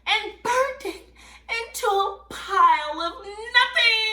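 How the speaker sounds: high, loud, dramatic story reading; excited storyteller. A narrator's reading. Storyteller telling children of the burning bridge, excited. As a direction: build the drama of a spreading fire.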